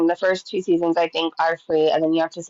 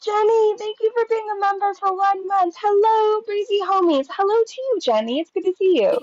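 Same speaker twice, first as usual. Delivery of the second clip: high, bright stream greeting; excited greeting; 12 semitones higher than usual. Real, not acted. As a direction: greet your fans with high enthusiasm.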